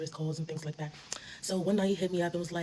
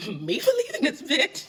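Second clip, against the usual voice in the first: laughing hard; 10 semitones higher, 9 dB louder, slower than usual. Real, not acted.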